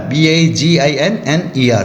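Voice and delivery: slow, letter-by-letter spelling of beginner. Shown as a demonstration. Teacher spelling beginner slowly for students, letter by letter. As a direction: spell B A जी I N N E R one letter at a time. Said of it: "जैसे beginner".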